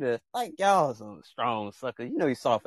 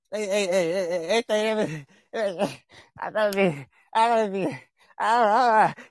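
mock stammering guy; 7 semitones higher than usual. Imitating someone backing down.

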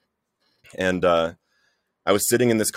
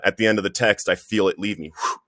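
ordinary talk, nothing marked

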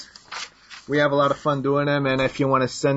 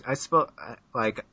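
normal talking voice

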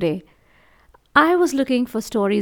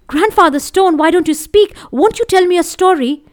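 high, louder urgent appeal; pleading young boy; 10 semitones higher, 9 dB louder than usual. A narrator's reading. Crow begs the silent stone for a story, pleading. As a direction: plead with it, pitched up.

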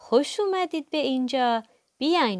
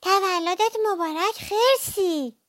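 high-pitched little mouse calling out; cheerful mouse shout; 8 semitones higher, 3 dB louder than usual. A narrator's reading. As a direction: call out a birthday greeting in a high mouse voice. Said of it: "موش فریاد زد". (فریاد شاد موش کوچولو با صدای زیر)

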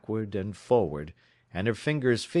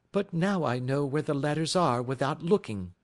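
Mary Sutherland voice; 7 semitones higher than usual. A narrator's reading. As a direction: lift into Mary's earnest, simple voice.

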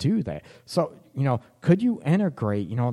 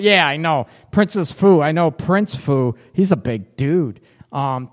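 usual speaking voice

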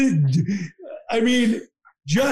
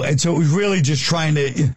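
ordinary delivery

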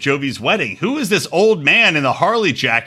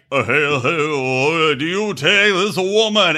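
lightly voiced slow [name] officiant; a hint of an [name] drawl, slow; [name] impression; slower than usual. Officiant at a Vegas wedding, faintly [name]. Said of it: "talk like [name]".

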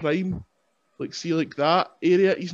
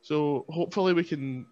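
ordinary talk

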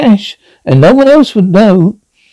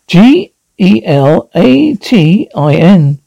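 slow spelling; slower than usual. Acted.

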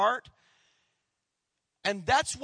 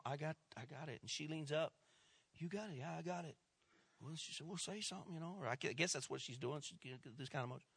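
much softer, drawing the room in close; hushed storytelling; 11 dB quieter, faster than usual. A narrator's reading. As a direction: soften down as if not to be overheard. Tense moment with the boy's ticket.

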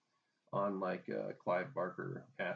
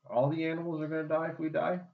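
incredulous; 7 semitones higher, 5 dB louder than usual. Real, not acted. Incredulous question.

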